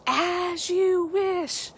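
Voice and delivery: high and very slow, voice changed; tender, drawn-out movie-line imitation; Westley's devoted line. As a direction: breathe it out like a lovesick hero. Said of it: "my rendition".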